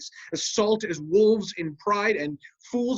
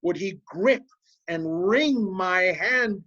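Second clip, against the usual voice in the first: normal talking voice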